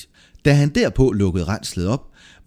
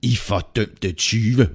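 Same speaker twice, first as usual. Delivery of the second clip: slow, drawn-out angry accusation; enraged innkeeper, words dragged out; furious shout; much slower than usual. A narrator's reading. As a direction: stretch out the curse in anger. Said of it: "råbte han rasende".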